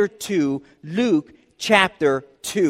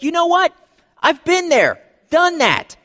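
higher-pitched, been-there-done-that delivery; breezy, know-it-all brush-off, pitched up; mock dismissive; 8 semitones higher than usual. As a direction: lightly act out someone who's seen it all. Voicing a jaded churchgoer's thought.

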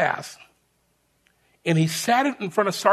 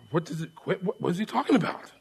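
hushed confusion; 9 dB quieter, faster than usual. Retold or imitated. Puzzled soldiers murmur to each other.

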